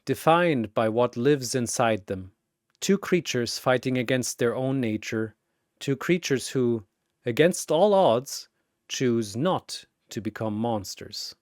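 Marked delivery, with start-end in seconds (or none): none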